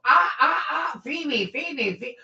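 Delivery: high squawking seagull cries of feed me. Retold or imitated. Mocking needy people as screeching gulls. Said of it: "like fucking seagulls".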